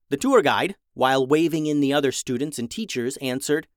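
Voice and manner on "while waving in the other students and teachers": ordinary delivery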